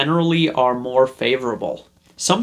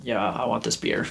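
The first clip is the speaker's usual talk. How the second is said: quiet, throwaway purchase line, much softer; low-key indifferent buyer; offhand and casual; 3 semitones lower, 8 dB quieter than usual. Retold or imitated. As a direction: say it like you couldn't care less. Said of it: "just going in and being like".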